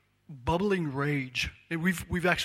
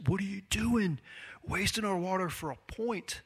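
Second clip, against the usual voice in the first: exasperated onlooker; 6 semitones higher than usual. Retold or imitated. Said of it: "sitting there raging".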